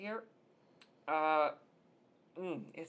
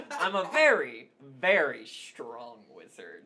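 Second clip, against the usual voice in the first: loud boast; 2 semitones lower, 12 dB louder, slower than usual. Acted. Boasting after laughing.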